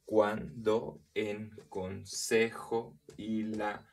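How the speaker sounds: very slow, every syllable set apart; syllable-by-syllable scansion. Shown as a demonstration. Teacher counting the syllables of a verse, slow scansion. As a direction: break the verse into separate, measured syllables.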